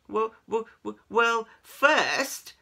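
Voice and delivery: high, loud, halting start; stammering. A narrator's reading. Keith put on the spot by cats, stammering. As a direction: stutter on the first word. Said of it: "stammered Keith".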